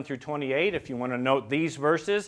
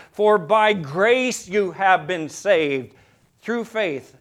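slow and louder, each word weighed; slow emphasis; 5 dB louder, much slower than usual. Acted. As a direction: let it land slowly. Emphatic scripture quote.

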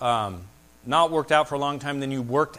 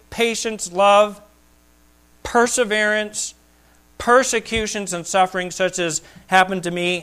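raised, emphatic scripture reading; preaching scripture; 8 semitones higher, 5 dB louder than usual. Acted. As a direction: proclaim each word to the congregation. Preacher reads scripture aloud.

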